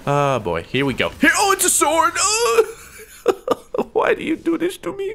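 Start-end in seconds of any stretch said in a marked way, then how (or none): 1.1-2.8 very high squeal of alarm; panicked shriek. Real, not acted. Gamer surprised by a sword, high panicked squeal. 3.9-5.1 high, pleading squeal; whining wail. Real, not acted. Gamer wailing at his attacker, high plaintive whine.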